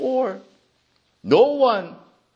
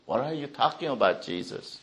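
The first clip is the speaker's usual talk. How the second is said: softer and fast, nearly whispered; hushed disbelief; 6 semitones lower, 10 dB quieter, faster than usual. Acted. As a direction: mutter it under your breath in disbelief. Crowd voiced in a hushed, fast take.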